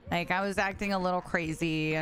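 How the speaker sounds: ordinary delivery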